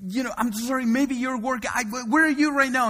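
imitating a flustered young caller; frantic apology. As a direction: stammer the apology in a rush. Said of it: "And she goes".

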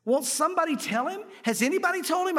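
high-pitched, incredulous appeal; mock exasperated plea. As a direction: plead as if nobody is listening. Exasperated pleading at TV interview.

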